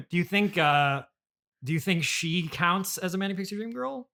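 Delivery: normal talking voice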